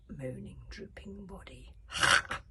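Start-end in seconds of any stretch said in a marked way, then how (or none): 1.9-2.4 sharp loud breathy ha; snapped scornful ha. A narrator's reading. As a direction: bark a contemptuous ha. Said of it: "snapped the voice".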